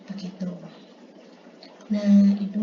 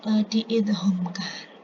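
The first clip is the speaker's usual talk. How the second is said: ordinary delivery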